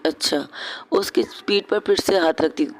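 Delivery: usual speaking voice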